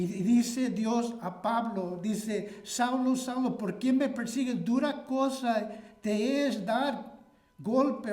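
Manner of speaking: loud, driving pulpit delivery; forceful preaching. Acted. Pastor thundering at his church, loud and driving. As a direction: preach it hard to the congregation.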